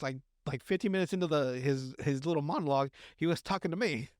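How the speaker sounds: ordinary talk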